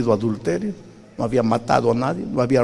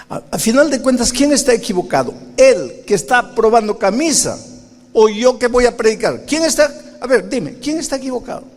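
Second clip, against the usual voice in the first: much higher, louder, outraged arguing; indignant protest; 10 semitones higher, 4 dB louder than usual. Retold or imitated. Preacher argues with the Spirit, high indignant protest. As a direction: protest hotly, pitch flying up.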